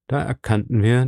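ordinary delivery

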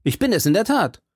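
high-pitched eager call; crying out. A narrator's reading. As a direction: call back to your friend, pitch lifted. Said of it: "schrie D'Artagnan".

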